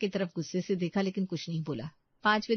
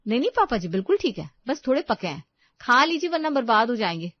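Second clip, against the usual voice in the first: louder, higher, brushing off the complaint; dismissive insistence; 5 semitones higher, 6 dB louder than usual. Acted. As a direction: insist briskly that it's fine. Daughter-in-law brushes off complaint. (ज़ोर देकर टालते हुए)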